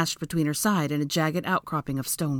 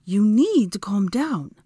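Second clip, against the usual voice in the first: slow, louder, higher command; insistent; 5 semitones higher, 5 dB louder, slower than usual. A narrator's reading. Hermione to Draco as he breathes raggedly, insisting. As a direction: insist slowly and forcefully. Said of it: "she insisted again".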